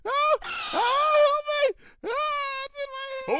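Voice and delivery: very high, slow, tearful screams; high sobbing shrieks of panic; crying for help. Acted. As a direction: wail in terror with a sob. Twilight screams, thing in hand.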